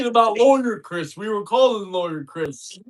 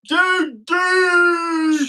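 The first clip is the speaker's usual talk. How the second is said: mock coach shout; 7 semitones higher than usual. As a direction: bark it like a hyped coach.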